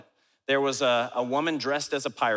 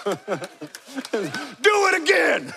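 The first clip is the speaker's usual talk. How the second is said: high, loud, drawn-out shout; stretched-out mock tough-guy challenge; Macho Man Randy Savage; 10 semitones higher, 5 dB louder, much slower than usual. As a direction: bellow a wrestler-style dare, hammed up. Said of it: "Macho Man Randy Savage style".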